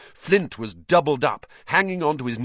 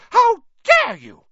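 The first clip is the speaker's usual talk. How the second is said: very high, loud, slow outraged shriek; piercing indignant scream; 12 dB louder, slower than usual. A narrator's reading. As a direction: shriek it in outrage, drawing out each word. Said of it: "Alicia shrieked".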